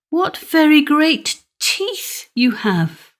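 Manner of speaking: put-on high, slow girlish voice; young girl marvelling slowly; awed little girl. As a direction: stretch it out in wide-eyed awe. Red Cap's last question.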